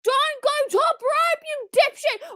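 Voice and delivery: high, drawn-out furious yell at the screen. Real, not acted. Fan yelling at wrestlers on TV, high angry yell. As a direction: berate the wrestlers at full voice.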